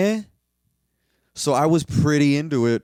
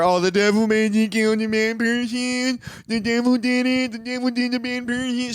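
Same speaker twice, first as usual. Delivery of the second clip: mocking voice; 9 semitones higher than usual. Mocking the film's devil defense.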